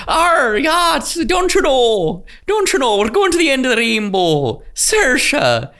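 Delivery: high, hammed-up Irish-Scottish brogue; Lucky Charms accent. Mocking Saoirse on the business call, hammed-up Lucky Charms accent. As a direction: lilt it like a cartoon leprechaun's mock brogue. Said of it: "that was like Lucky Charms".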